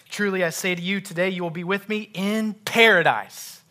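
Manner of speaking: loud, high reading climbing into "in paradise"; emphatic PARADISE at the end; stress on IN PARADISE. Shown as a demonstration. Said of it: "just emphasize a different word".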